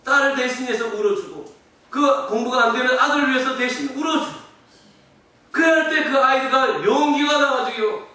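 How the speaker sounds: loud, high, pleading sermon voice; impassioned preaching. Real, not acted. Parent praying for a failing child, impassioned preaching. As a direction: cry out to God, building in force.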